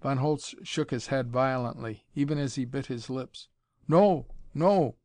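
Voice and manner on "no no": protesting. A narrator's reading. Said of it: "he protested".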